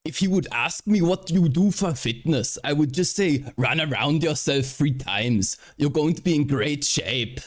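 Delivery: joking Austrian bodybuilder voice; Schwarzenegger impression. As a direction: ham up a heavy Austrian accent. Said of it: "Terrible accent, this is perfect".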